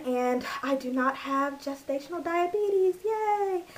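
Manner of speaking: high joyful cheer on yay; bursting with relief; excited. Real, not acted. Relieved good news from doctor.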